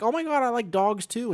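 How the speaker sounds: high, loud, gushing imitation; mock excited reaction. Imitating people who misheard his Thai, mock excitement. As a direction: act out a listener's delighted misunderstanding. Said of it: "and people are like".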